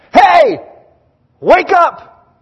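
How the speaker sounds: high, loud wake-up shout. Retold or imitated. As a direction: yell across to rouse a sleeping camp. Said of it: "he shouts at him".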